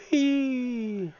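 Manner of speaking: high squealing whee for the flying fish; gleeful whee. Pretend. Streamer voicing a flying fish, high gleeful whee. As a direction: cheer the fish into the air like a kid.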